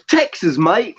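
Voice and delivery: high-pitched fake British accent drifting into Australian; botched British accent. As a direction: try a British accent and miss towards Australian. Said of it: "I think that's Australian".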